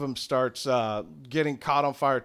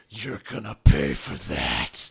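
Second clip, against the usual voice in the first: low, threatening goblin voice; deep villain's vow of revenge; menacing goblin general; 11 semitones lower than usual. Acted. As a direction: growl a threat, pitched way down. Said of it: "looks directly at you and goes".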